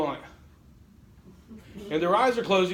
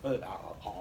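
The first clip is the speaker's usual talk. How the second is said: low goofy grunting; lowered goofy uh uh uh noise; silly noises; 10 semitones lower, 9 dB quieter than usual. Shown as a demonstration. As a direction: make a dumb attention-grabbing grunt. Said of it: "you can do stupid stuff like".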